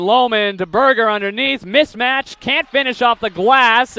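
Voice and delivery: normal talking voice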